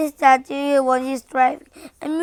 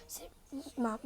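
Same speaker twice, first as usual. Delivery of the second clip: usual speaking voice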